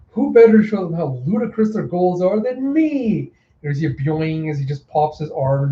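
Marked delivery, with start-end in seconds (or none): 0.1-3.3 high, swooping grandiose boast; hammed-up clown villain flourish; theatrical clown. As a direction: sweep the pitch up like a showman.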